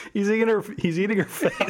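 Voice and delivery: high, loud cry of a horrified bystander; hammed-up shriek of alarm; mock alarm. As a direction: imitate a panicked onlooker, half laughing. Alarmed bystander quoted with glee.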